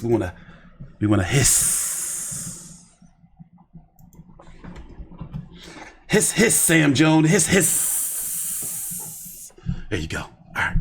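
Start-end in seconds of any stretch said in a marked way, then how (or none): 1.0-9.5 drawn-out hissing at a fighter's name; snake hiss. Pretend. Streamer jeering a boxer he dislikes, hissing like a snake. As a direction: hiss like a snake to jeer him. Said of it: "We want more hiss".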